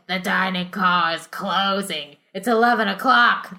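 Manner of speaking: lightly done Brooklyn waiter; a hint of a Brooklyn accent; a waiter speaking with a mild Brooklyn accent. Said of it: "the accent I'm doing is from".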